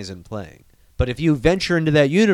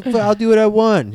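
higher, louder bratty kid imitation; mock defiant nephew; 8 semitones higher, 5 dB louder than usual. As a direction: imitate a kid talking back, loudly. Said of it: "He's like".

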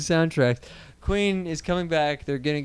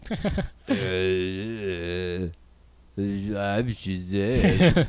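very slow, low mumbled movie-star impression; Vin Diesel; 6 semitones lower, much slower than usual. Imagining the actor cast as Shazam, slow low impression. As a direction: drag it out deep and sluggish. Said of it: "Vin Diesel?".

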